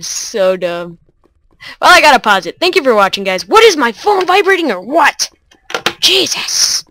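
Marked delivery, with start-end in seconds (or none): 3.5-6.8 higher, louder startled outburst. Real, not acted. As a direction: jump at a sudden shock. Startled by phone.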